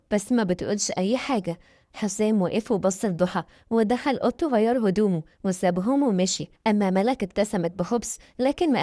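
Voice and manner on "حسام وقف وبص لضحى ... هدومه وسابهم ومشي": ordinary delivery, nothing marked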